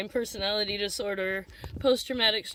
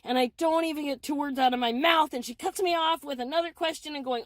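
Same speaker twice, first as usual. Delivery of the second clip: ordinary delivery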